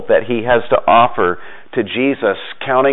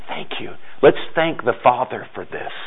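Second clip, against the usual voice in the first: much softer, gentle thanks; soft Jesus voice; 6 dB quieter than usual. Retold or imitated. Jesus receiving a man's small offering, softly. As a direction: hush down to a tender thank-you.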